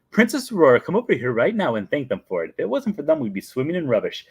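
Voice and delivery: high, loud opening, scolding nanny; Mary Poppins. Retold or imitated. Nanny scolding princess to thank custodians, put-on voice. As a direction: order her over in a mock-stern nanny voice. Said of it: "And she's like".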